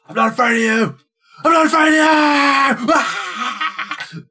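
high, loud, escalating yell; mock defiant shout. Film fan acts out the hero facing the old lady, shouting defiantly. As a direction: scream it out, hammed-up and stretched.

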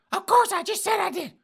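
put-on high, loud huffy old lady; old woman voice. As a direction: squawk it out like an outraged old lady.

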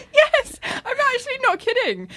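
high, excited squeal through laughter; insist, shrieking with laughter; laughing insistence. Real, not acted. Friend confirming the absurd credit card plot, laughing insistence.

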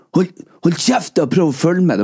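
high irritated mock shout; imitating annoyed audience telling hecklers off; angry shushing. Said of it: "så da sa de andre sånn".

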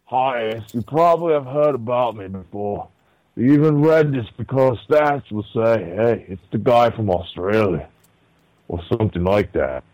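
usual speaking voice

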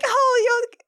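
high, hammed-up wounded whine; hurt vampire brides. Mocking the brides complaining to Dracula, high whine. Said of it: "And they're like".

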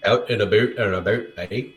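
low mock Canadian "aboot"; mock Canadian accent. Teasing a Canadian friend about his vowels, mock Canadian accent.

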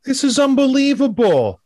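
high, slow mock amazement; hammed-up sarcastic praise; sarcastic awe. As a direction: gush with fake wonder.

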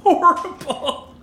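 high, squealing laughter in disgust; laughing disgust. Real, not acted. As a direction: laugh through the horror. Laughing disgust at awful taste.

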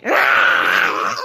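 loud, long, rasping roar; monster roar. A narrator's reading. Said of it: "And it said".

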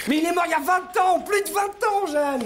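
exasperated. Acted. Exasperated correction of friend.

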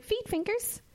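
high mock voice of the Irish teacher; lightly voiced teacher saying it matter-of-factly; imitating teacher.

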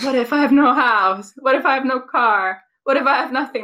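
high, loud dramatic delivery; dramatic quote. Retold or imitated. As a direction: ham up the lyric. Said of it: "when he goes into, like".

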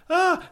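very high, loud imitated shriek; mock scream. Mum on swaying boardwalk, imitated high shriek. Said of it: "she'd be like".

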